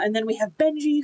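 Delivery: normal talking voice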